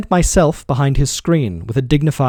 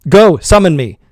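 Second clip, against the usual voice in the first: loud, higher dare; roared; 6 semitones higher, 8 dB louder than usual. A narrator's reading. Master roaring a dare at his servant. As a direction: roar the dare. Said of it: "I roared".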